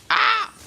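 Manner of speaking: very high, sudden yelp; sharp high-pitched scream; short scream. Real, not acted. Player startled mid-fight, short scream.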